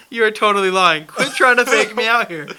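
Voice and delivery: loud teasing call-out. Retold or imitated. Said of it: "And so there I was like".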